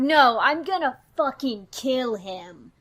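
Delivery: loud, furious refusal; pissed off. Acted. Nikki refusing her partner's plea, pissed off. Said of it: "is really pissed off on behalf of Cecil".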